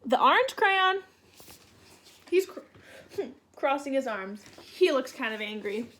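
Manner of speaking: usual speaking voice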